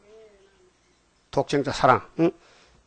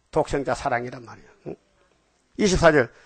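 usual speaking voice

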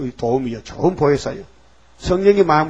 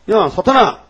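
louder, higher call out; rebuking shout; 5 semitones higher, 5 dB louder than usual. Acted. Archangel confronting Satan over Moses' body, loud. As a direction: snap at Satan to stop.

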